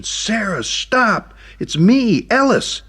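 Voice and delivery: a high, loud plea to stop; shouting after her. Acted. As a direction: run and yell for her to wait.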